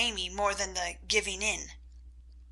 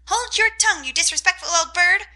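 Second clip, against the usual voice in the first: high, loud parrot parroting the scold; parrot mimicking; 8 semitones higher, 5 dB louder than usual. A narrator's reading. Parrot copying the aunt's scold, high squawk. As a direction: squawk the words back like a parrot. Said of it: "cried Polly".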